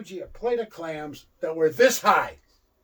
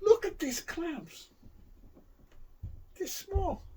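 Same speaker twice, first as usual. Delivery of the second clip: high, slow, disgusted complaint; indignant Fuji; 11 semitones higher, 9 dB quieter, slower than usual. Retold or imitated. Fuji to the waitress, slow high disgusted complaint. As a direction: drag it out high, appalled at the clams. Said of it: "He goes".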